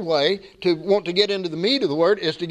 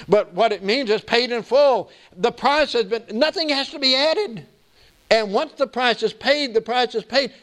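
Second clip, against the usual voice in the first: higher-pitched, insistent sermon delivery; preaching; 7 semitones higher than usual. Real, not acted. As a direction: press the doctrine home to the congregation. Preaches in raised voice.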